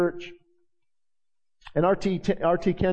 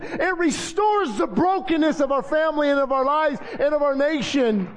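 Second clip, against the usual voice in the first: preaching at full force; 10 semitones higher than usual. Real, not acted. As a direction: preach it with rising fervour to the church.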